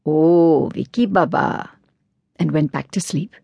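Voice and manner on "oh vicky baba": drowsy doorkeeper mumbling a greeting; lightly voiced sleepy doorkeeper; sleepy mumble. A narrator's reading. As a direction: mumble it half asleep. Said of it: "as he mumbled".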